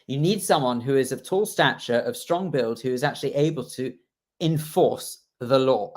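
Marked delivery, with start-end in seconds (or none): 4.4-5.9 enforce the law, slow, leaning on force; slow emphatic enforce; stress on force. Shown as a demonstration. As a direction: drag out enforce and press the force. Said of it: "the emphasis on the word force".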